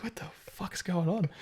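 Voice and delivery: hushed, near-whispered bewilderment; baffled aside. Retold or imitated. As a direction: whisper it like a confused bystander. Bystander's baffled reaction, retold.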